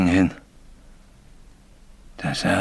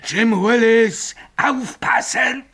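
high, drawn-out anguished calling; imitated ghost call; 12 semitones higher, slower than usual. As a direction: mimic the haunting call, climbing higher. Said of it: "Die Stimme kann man nicht überhören". (hoher, langgezogener, gequälter Geisterruf)

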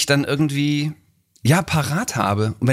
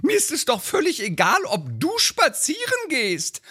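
hohe, drängende Ehefrauenstimme; nörgelnde Ehefrau; 12 semitones higher than usual. Acted. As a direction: den Mann antreiben. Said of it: "so ein Lieblingstext". (Ehefrau drängt ihren Mann, hohe Stimme)

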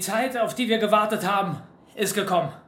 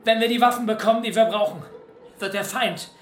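rousing speech; 6 semitones higher than usual. Acted. Leader rallying fighters before battle.

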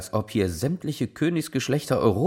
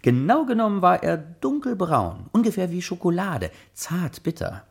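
playful; 8 semitones higher than usual. Acted. Joking description.

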